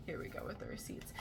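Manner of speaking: conspiratorial whisper; hushed teasing whisper. Real, not acted. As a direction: whisper it like sharing a secret. Teasing the receipts.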